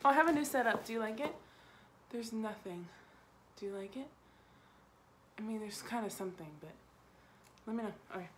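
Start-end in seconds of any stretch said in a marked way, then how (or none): none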